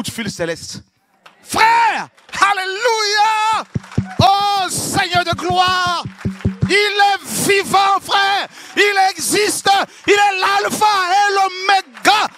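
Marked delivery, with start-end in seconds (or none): 1.4-12.3 high, loud, slow drawn-out praise shouts; fiery preaching. Real, not acted. Preacher to congregation, full-force hallelujah preaching. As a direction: lift the whole room with hallelujah.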